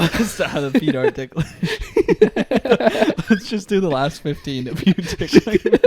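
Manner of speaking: laughing through words while tickled; being tickled. Real, not acted. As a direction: squirm and laugh between the words.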